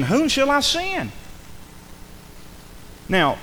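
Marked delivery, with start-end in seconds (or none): 0.0-1.1 high, drawn-out calling; voice of the Lord. Acted. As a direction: call out like a summons from heaven. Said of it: "a voice of the Lord saying".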